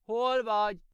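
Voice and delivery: calling out. A narrator's reading. Girl calling for grandma.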